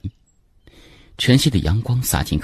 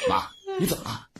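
alarmed cry; 11 dB quieter, faster than usual. A narrator's reading. Son finds mother wailing.

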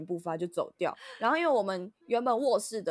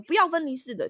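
higher, louder curt refusal; sharp refusal; 8 semitones higher, 7 dB louder than usual. Retold or imitated. Imitating the landlady's curt refusal. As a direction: shut the idea down sharply. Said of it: "他突然很凶的讲说".

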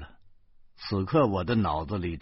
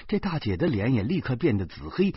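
plain reading voice

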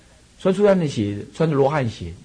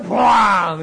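a sharp mock bang of a falling tree; imitated crash; 5 semitones higher than usual. A monk telling students how the old tree crashed down. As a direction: spit out the crash like a sudden boom.

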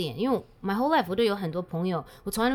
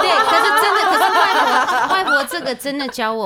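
laughing; 7 semitones higher, 12 dB louder than usual. Real, not acted. Guest laughs recalling her grandmother.

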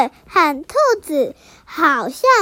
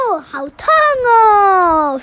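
mock ouch; 3 semitones higher, 5 dB louder, slower than usual. Squirrel hit on head by nut.

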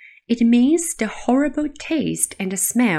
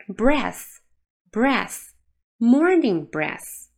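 slow model reading; much slower than usual. Shown as a demonstration. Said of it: "slow model for repetition".

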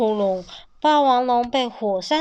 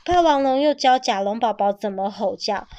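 ordinary delivery

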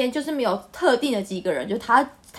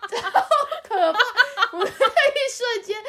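laughing; 8 semitones higher than usual. Real, not acted. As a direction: laugh hard through it. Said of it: "laughing while telling". (高声大笑着讲)

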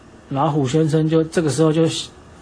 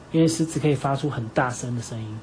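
ordinary delivery, nothing marked

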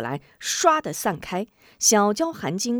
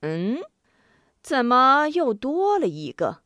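surprised; 5 semitones higher than usual. A narrator's reading. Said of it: "突然看见张秀秀".

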